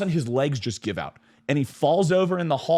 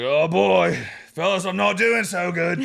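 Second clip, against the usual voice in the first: higher, loud mock drunk voice; wobbly drunk guy; 5 semitones higher, 5 dB louder, slower than usual. Drunk guest confessing to his friends he's not well, hammed-up. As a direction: imitate a drunk man about to topple. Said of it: "this guy's walking out, he's like".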